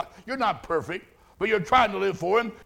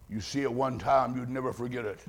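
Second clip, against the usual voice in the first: ordinary talk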